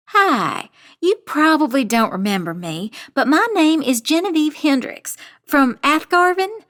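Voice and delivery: bright friendly phone voice with a rising end; smiling, friendly voice. A narrator's reading. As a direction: smile through the words to sell the lie. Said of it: "I smile, knowing it will make my voice sound friendlier".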